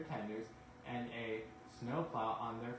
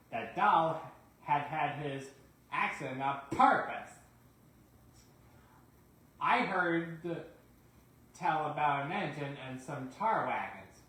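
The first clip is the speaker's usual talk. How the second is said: sly engine; 3 semitones higher, 8 dB louder than usual. Acted. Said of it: "said Douglas".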